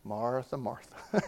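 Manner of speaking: Jesus smirking at busy Martha, soft and tender; tender, amused. Retold or imitated. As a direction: say the name softly, gently amused. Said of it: "he kinda smirks".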